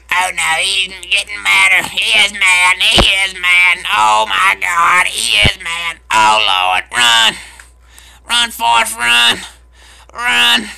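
loud, high teasing cries; mock alarm. Real, not acted. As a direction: holler a jeering warning. Mock alarm at angry player.

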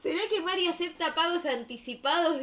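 mock excited question; reading a chat message. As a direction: read it out as the viewer asking. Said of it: "Dice eso". (leyendo en voz alta la pregunta de un espectador)

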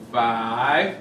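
ordinary talk, nothing marked

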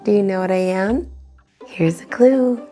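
ordinary delivery

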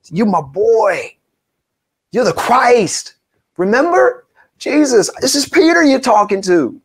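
pitched high, insisting on loyalty; pleading boast. Acted. Peter protesting to Jesus he won't leave, high and insistent. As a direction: sell it like a hurt friend.

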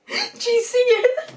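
laughing. Real, not acted. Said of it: "Breathe, breathe".

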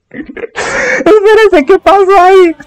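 high squealing laugh, stunned; real shocked fangirl squeal. Real, not acted. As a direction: gasp it out way up high. Shocked by the push scene.

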